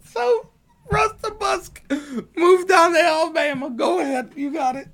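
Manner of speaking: mock-egging Russ on in a very high weird accent. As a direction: squeal it in a strange made-up accent. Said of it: "I'm speaking in this weird accent".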